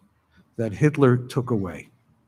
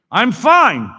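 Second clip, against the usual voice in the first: sharp, loud, much higher "I'm fine"; curt refusal; 9 semitones higher, 11 dB louder than usual. Retold or imitated. Old survivor snapping at the camp leader, loud and curt. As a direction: brush off the stranger hard.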